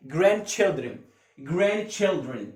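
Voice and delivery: ordinary talk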